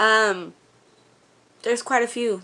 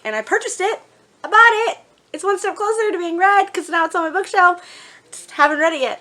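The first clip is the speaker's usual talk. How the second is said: higher, louder, gleeful boast; excited; 10 semitones higher, 5 dB louder than usual. Real, not acted. Telling viewers she finally bought the book, excited. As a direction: squeal it out proudly.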